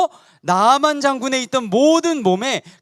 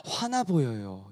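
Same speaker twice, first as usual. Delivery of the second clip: 속삭이듯 낮게; 11 semitones lower, 10 dB quieter, slower than usual. Acted. 아이들에게 극적으로 속삭임.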